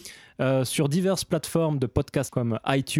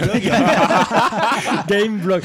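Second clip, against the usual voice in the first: laughing through the word Gameblog; laughing, tripping over Gameblog; laughing stumble; 6 semitones higher, 6 dB louder, much slower than usual. Real, not acted. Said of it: "c'est mon accent du sud".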